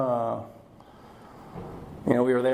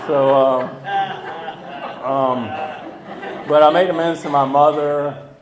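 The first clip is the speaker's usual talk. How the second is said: loud laugh spilling into the words; laughing loudly; 3 semitones higher, 9 dB louder, slower than usual. Real, not acted. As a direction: talk on through a real burst of laughter. Laughing after sponsor's joke.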